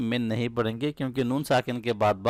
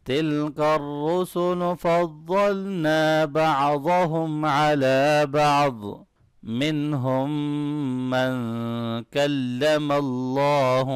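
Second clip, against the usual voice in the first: slow, drawn-out chanted Quran recitation; measured tajweed chant; 3 semitones higher, slower than usual. Shown as a demonstration. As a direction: recite it slowly like a qari. Teacher recites verse before rules.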